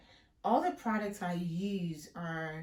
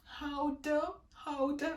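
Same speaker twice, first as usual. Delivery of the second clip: put-on child voice; 7 semitones higher, slower than usual. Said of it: "the child was like".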